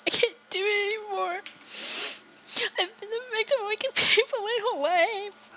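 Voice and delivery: put-on high, crying boy's voice; a broken child at the end of his rope; tearful Stewie. Streamer voicing Stewie pleading through tears.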